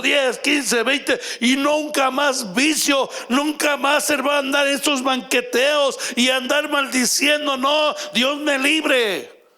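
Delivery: fervent testimony. Acted. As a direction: preach it out with rising fervour.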